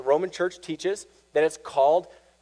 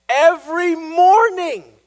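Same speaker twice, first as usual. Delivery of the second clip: "every morning" much higher, louder, drawn out slowly; emphatic, slow, raised "every morning"; stress on every morning; 12 semitones higher, 10 dB louder, slower than usual. Real, not acted. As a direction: stretch and belt "every morning". Stressing Luther's endless confession.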